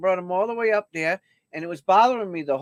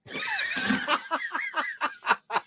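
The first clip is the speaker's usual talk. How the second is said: high laugh; 6 dB quieter than usual. Real, not acted. Host cracking up at own joke.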